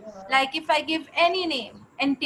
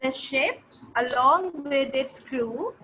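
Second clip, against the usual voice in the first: slower, spelled-out repeat for a student; slower than usual. Shown as a demonstration. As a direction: say it again slowly and clearly. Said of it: "If the teacher can ask one more time".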